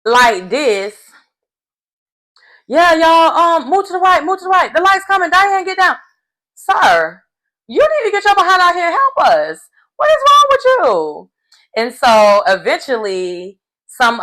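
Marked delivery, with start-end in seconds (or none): 2.7-6.0 high-pitched mocking put-on voice; hammed-up high sing-song instructions; mock lazy sideliner. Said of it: "Miles is sitting back like this". 6.5-11.3 high-pitched exasperated telling-off; hammed-up high, indignant reprimand; mock scolding. Scolds Miles for not helping.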